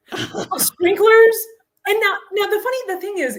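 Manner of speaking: stretched, exasperated exclamation; exasperated outburst. Real, not acted. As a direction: wail it in comic disbelief. Exasperated punchline about runaway sprinklers.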